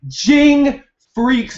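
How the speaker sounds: high, slow, drawn-out name; excited. Retold or imitated. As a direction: stretch the name out in disbelief. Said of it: "I flipped out. I'm like".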